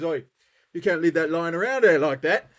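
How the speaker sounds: a loud, gruff barman's "Oi"; gruff bartender. Acted. Joke teller voicing the bartender telling off the man, loud. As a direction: bark it like an annoyed pub landlord. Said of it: "he says".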